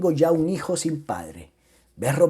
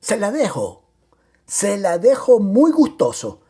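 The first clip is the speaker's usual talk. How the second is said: emphatic scornful concession; 5 semitones higher, 6 dB louder than usual. Acted. Mockingly hands back the daughter.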